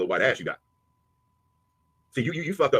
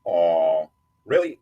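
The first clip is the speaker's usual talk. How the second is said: ordinary talk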